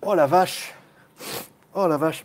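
normal talking voice

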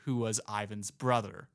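ordinary talk

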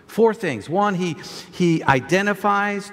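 normal talking voice

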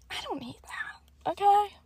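hushed whisper to herself. Retold or imitated. Narrator whispering her own thoughts in the store. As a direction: whisper it like a private decision.

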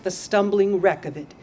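usual speaking voice

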